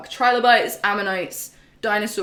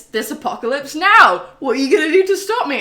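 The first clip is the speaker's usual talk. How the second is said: louder, higher, fast taunting challenge; mock villain taunt; 7 semitones higher, 9 dB louder than usual. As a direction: dare the hero, voice climbing. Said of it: "And Higgs is like".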